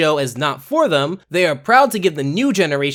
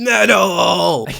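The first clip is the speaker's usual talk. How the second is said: imitating metal shout. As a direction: try the "Metal!" shout and fall short. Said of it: "I can't do it".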